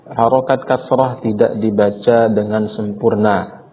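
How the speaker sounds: normal talking voice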